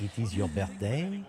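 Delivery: It is your birthday with a French lilt, rising; French accent. Shown as a demonstration. Friend models the line for her to copy, French accent. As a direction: say It is your birthday like a French speaker trying English. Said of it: "no, the whole sentence".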